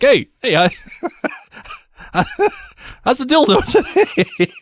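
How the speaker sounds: high, chipper mock greeting through laughs; mock pop culture greeting. Podcaster jokingly imagining pop culture chatter, in a high mock-cheery voice.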